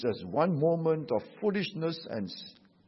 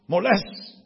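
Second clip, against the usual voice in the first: retold shout; 5 semitones higher, 5 dB louder than usual. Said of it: "The woman shouted".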